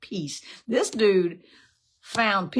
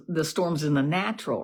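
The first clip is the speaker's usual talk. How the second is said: ordinary talk, nothing marked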